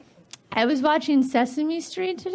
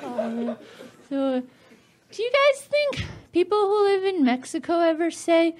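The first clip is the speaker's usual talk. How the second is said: ordinary talk, nothing marked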